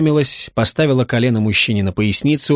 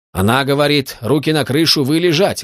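usual speaking voice

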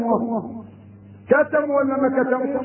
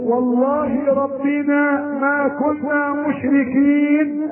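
drawn-out oath; much slower than usual. Retold or imitated. Quoting the oath very slowly.

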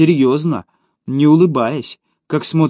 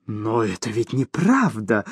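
low, slow, softer protest; indignant objection; 4 semitones lower, 5 dB quieter, slower than usual. A narrator's reading. Fat man disputes the woman's accusation, mildly indignant. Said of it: "возмутился Толстенький".

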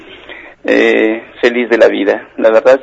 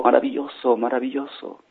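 very soft echo dying away; distant receding cave voice; fading echo; 12 dB quieter than usual. Acted. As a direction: repeat it quieter each time. Tunnel echoes the father.

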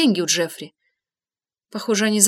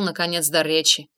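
usual speaking voice

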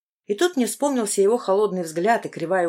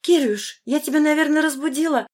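higher, brisk mother's voice; a bright, wide-awake mum on the phone; mother, cheerful; 9 semitones higher, 3 dB louder than usual. A narrator's reading. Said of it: "произнесла мама довольно бодрым голосом". (бодрый мамин голос, выше)

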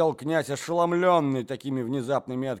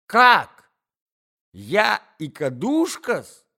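высокий громкий взвизг возмущения; взвизгни от обиды; визг возмущения; 9 semitones higher, 11 dB louder, slower than usual. Acted. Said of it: "взвизгнула Наталья Дмитриевна". (визгливое возмущение дамы)

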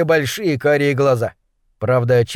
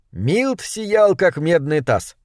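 ordinary delivery, nothing marked

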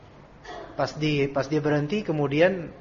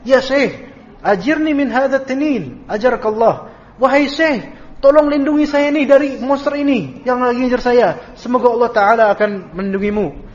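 desperate plea; 6 semitones higher, 4 dB louder than usual. Retold or imitated. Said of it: "aku bilang ke syekh itu".

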